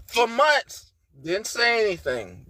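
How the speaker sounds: ordinary talk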